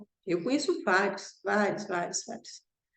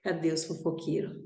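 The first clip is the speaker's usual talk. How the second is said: ordinary delivery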